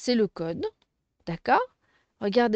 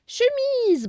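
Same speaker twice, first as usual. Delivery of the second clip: "Chemise" high and a bit louder, in one go; whole word, exclaimed; 13 semitones higher, 5 dB louder than usual. Shown as a demonstration. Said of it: "on va séparer les syllabes".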